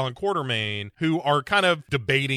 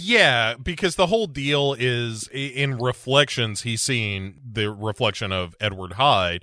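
ordinary talk, nothing marked

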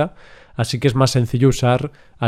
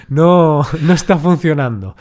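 louder, higher half-joking scold; mock exasperated mother; 4 semitones higher, 5 dB louder than usual. Mother telling her son school isn't working, loud and exasperated. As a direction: mum throwing up her hands over school.

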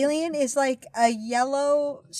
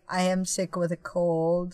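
mock sick; 6 semitones lower, slower than usual. Poor Melissa sitting there, sick.

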